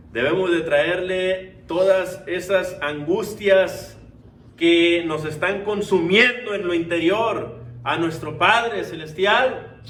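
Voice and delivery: loud, slow, weighty exhortation; forceful preaching. Real, not acted. Preacher urges believers to bring their anguish to God, preaching forcefully. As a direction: press each phrase out to the congregation.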